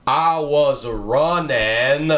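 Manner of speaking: high, slow, strange Forrest Gump voice. Retold or imitated. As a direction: stretch it out as a dim Forrest Gump. Forrest Gump quote continues.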